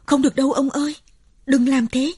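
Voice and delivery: terrified pleading outcry; frantic cry. A narrator's reading. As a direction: cry out begging him to stop. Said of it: "Bà hai vội kêu lên".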